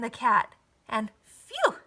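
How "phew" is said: high relieved phew of breath; dog relieved the danger has passed. A narrator's reading. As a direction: blow it out with relief. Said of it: "said the dog".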